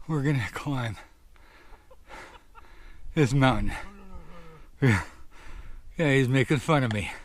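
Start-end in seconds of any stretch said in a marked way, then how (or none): 4.8-7.1 out of breath. Real, not acted. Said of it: "Let me catch my breath here".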